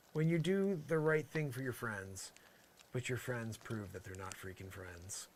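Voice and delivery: usual speaking voice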